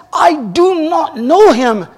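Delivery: high, slow, strained denial, a touch of panic; Peter's denial. Retold or imitated. Peter denying Jesus under pressure, high and slow. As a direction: drag out each word as the cornered disciple.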